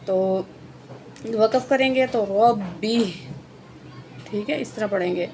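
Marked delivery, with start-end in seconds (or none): 2.3-3.0 ربہ with a heavy r and a hard, doubled b; stop with stress. Shown as a demonstration. Teacher shows the pause and hard b to students. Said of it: "با کو سختی سے پڑھیں گے".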